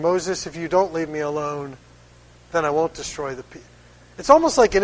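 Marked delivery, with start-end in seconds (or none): 0.0-3.7 softer, a knowing hint. Retold or imitated. God hinting softly to Moses. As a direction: drop the voice to drop a hint. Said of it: "God's kind of hinting".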